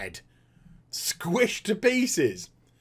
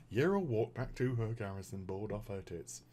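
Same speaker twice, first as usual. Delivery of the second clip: very low, hushed deadpan; mock subtitle narration; 10 semitones lower, 15 dB quieter than usual. Subtitle at the end of the cutscene, hushed deadpan. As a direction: intone it softly, fading away at the end. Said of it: "some sort of subtitle that came up".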